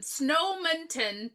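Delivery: "Snowminton town" stretched out slowly; slow, deliberate "Snowminton town"; drawn-out name. Shown as a demonstration. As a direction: draw out each part of "Snowminton town". Repeats the town's name drawn out.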